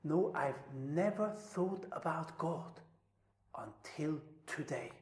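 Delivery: soft, near-whispered admission; hushed confession. Retold or imitated. Survivor telling interviewer about God, near whisper. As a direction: let it fall almost to a whisper. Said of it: "And he said".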